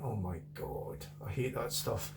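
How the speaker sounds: low, quiet, slow disgusted mutter; disgusted groan. Retold or imitated. Retelling his first pint of Guinness, slow quiet disgust. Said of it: "I went".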